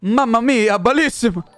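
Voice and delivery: chef's-kiss smack, then exuberant cartoon Italian; mock Italian. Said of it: "it's Italian".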